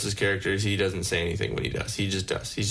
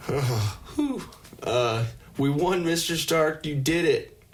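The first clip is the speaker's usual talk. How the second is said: put-on teen hero; 6 semitones higher than usual. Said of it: "He said".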